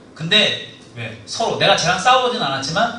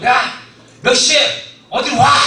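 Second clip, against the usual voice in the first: higher, louder, slow summons; mock challenge call; 10 semitones higher, 6 dB louder, slower than usual. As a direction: call out the rival to a fight. Calling out fight time.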